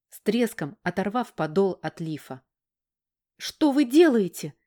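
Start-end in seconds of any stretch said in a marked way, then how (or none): 3.4-4.5 high, loud outraged protest; indignant outcry. Acted. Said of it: "возмутилась".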